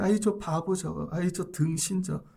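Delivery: soft sneer with a pout; pouting scorn. Acted. Onlookers pouting at David, muttered scorn. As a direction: mutter it aside in soft contempt. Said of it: "입을 삐죽거리며".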